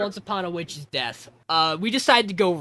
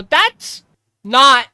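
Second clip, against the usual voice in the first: startled outburst; 6 semitones higher, 13 dB louder than usual. Retold or imitated. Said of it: "And I go".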